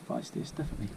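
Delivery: usual speaking voice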